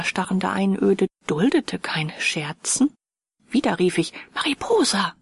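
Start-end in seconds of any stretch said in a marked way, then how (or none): 4.3-5.1 mild plaintive call. Acted. Narrator calls for Mariposa again, lightly plaintive. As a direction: call her name lightly lamenting. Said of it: "rief es klagend".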